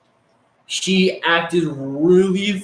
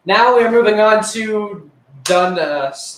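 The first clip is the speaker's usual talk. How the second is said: higher, slow announcer voice; 6 semitones higher, 3 dB louder, slower than usual. Acted. Boy announcing the next chapter of his stories, slow show-host voice. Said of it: "announcing next chapter".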